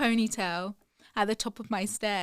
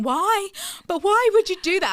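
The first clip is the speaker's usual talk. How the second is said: high, louder anguished question; upset pleading; 10 semitones higher, 7 dB louder than usual. Retold or imitated. Mother asking her daughter why she cut her hair, high and upset. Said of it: "I just kept saying".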